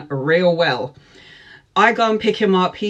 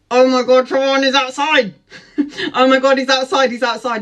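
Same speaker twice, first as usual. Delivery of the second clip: high, loud, excited squeal with laughter; giddy panic; 6 semitones higher, 4 dB louder than usual. Retold or imitated. On the phone to best friend as he pulls up, giddy panic. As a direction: blurt it out in a hammed-up fluster.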